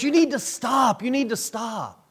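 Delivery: urgent warning. Retold or imitated. Warning a man walking on fresh lava, urgent call. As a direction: call out to halt someone in danger.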